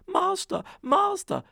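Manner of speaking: very high, loud alarm cry; shrill warning to wake the master; harp calling. Acted. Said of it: "the harp called out".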